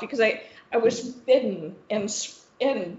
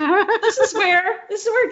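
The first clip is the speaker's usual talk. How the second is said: laughing; 10 semitones higher, 5 dB louder than usual. Real, not acted. The host laughs at losing her own story, laughing. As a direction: laugh as you try to get back on track.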